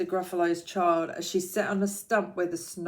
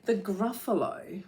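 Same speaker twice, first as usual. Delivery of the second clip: ordinary delivery, nothing marked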